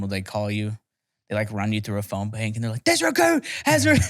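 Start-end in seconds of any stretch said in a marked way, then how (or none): 2.8-4.0 very high mock recruit yell; screaming recruit. Recruit phoning home from boot camp, high mock scream. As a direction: imitate a recruit screaming a set script.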